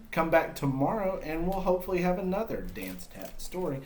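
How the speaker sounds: ordinary delivery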